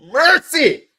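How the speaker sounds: high, loud pained yelp; real anguished fan cry. Real, not acted. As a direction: cry out at the miss.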